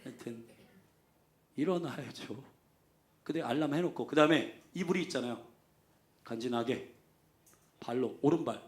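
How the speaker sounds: ordinary delivery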